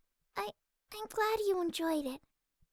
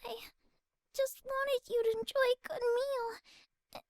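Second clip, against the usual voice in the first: higher, on the edge of tears; voice shaking; 5 semitones higher than usual. Acted. Fluttershy near tears over the ruined dinner. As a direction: hold back tears as you speak. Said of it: "Her voice shook as she tried to hold herself back".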